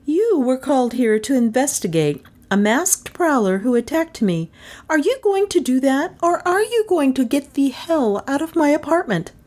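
high, indignant woman laying down the law; high-pitched outraged demand; loud and clear. A narrator's reading. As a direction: order them out in a high, clear voice. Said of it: "she said loudly and clearly".

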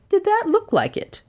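put-on sharp elderly question; old lady voice. Cornelia questions detective.

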